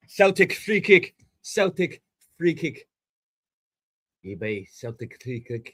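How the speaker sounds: lightly put-on Scottish accent; mock-Scottish football call; terrible Scottish accent. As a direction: attempt a bad Scottish accent. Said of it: "Terrible Scottish accent".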